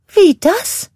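high, shrill-free quick question; a high curious witch; witch sister asking. A narrator's reading. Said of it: "fragte Martha". (hohe fragende Hexenstimme)

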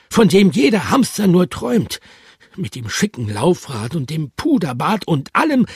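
high protesting hamster with a lisp. Acted. Bertram protests to his friends, lisping. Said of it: "protestierte Bertram lispelnd".

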